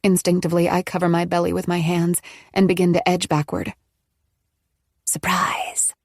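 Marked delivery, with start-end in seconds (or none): 5.0-5.9 soft breathy whispered surprise. A narrator's reading. Nola greets trapped Maggie, whispering. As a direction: whisper it like a shared secret. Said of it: "Nola whispers".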